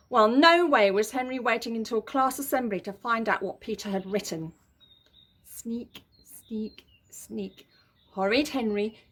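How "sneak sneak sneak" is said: softer sneaky beat; sneaky hush. A narrator's reading. Narrator creeping along with Henry, hushed. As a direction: creep the words quietly.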